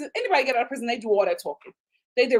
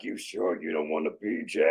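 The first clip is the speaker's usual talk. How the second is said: very low, softer smoker's voice; smoker woman; 7 dB quieter than usual. Retold or imitated. Smoker woman offering the man a favour, very low voice. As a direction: imitate her deep cigarette voice, rising into a question.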